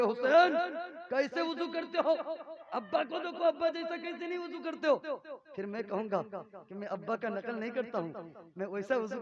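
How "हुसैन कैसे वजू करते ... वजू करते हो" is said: a touch of a big brother's scolding, higher; mock scolding. Hasan scolding Husain about his wudu, mock scolding. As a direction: playfully scold a little brother. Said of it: "तुम हमको धमका के कहोगे".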